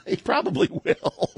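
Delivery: high-pitched, laughing through the words. Real, not acted. Agreeing with a co-host's joke about Biden, laughing. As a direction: squeak it out mid-laugh.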